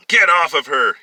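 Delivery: forceful shouted command; yelling. A narrator's reading. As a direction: yell at an attacker while rushing in. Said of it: "he yelled".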